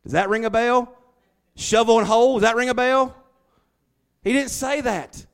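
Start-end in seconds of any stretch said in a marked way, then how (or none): 0.0-2.3 loud, higher teasing question; preacher playing Joseph's taunt; mock taunt. Mocking brothers, quoting Joseph. 2.3-3.2 even louder, higher jeering question; preacher pushing Joseph's taunt further; louder mock taunt. Mocking brothers, quoting Joseph.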